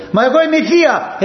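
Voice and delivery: an aunt who can't believe she's sent out; indignant aunt. Acted. Aunt protests being thrown out.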